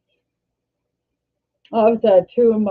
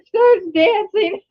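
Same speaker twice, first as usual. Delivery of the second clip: laughing through tears; 11 semitones higher, 3 dB louder, slower than usual. Real, not acted. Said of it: "I don't know why I'm teary today".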